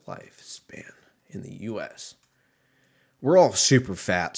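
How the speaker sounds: ordinary talk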